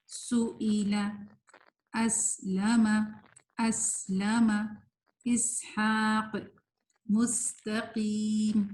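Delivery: slow words with lingering whistled س; held sibilant. Shown as a demonstration. Teacher reading examples for students, slow held س. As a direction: stretch اسلم and مستقیم, hissing the س. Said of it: "تھوڑی دیر رہنی چاہیے".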